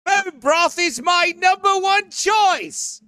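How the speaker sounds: high, slow prissy voice; mock him sweetly, drawn out; mocking Aaron. Teasing Aaron about loving bone broth, high slow mock voice.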